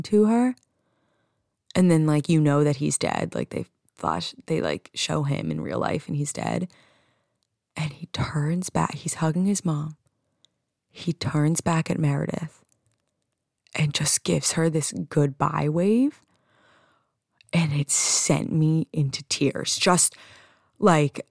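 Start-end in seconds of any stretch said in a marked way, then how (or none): none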